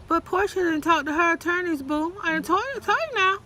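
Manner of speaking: high, sassy I done told you. Real, not acted. Sassy address, rising pitch.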